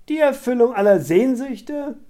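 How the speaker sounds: high, loud, scornful echo; mocking cry. A narrator's reading. Scarlett jeers at Barry's suggestion, loudly mocking it. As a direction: throw it back as a derisive question. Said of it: "rief Scarlett höhnisch".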